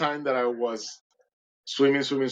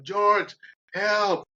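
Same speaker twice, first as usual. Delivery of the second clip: calling out; 8 semitones higher than usual. Retold or imitated. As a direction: call George across the water. Calling friend while drowning.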